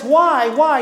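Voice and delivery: put-on a kid's rising, pleading why, why; whiny kid. Kid pestering his parent for a reason, whining. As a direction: beg for a reason like a pestering child. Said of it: "When this kid says".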